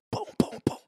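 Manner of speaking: punch sound effects. Retold or imitated. Imitating the punches.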